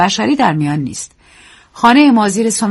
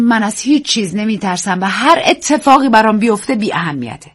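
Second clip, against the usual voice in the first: ordinary delivery, nothing marked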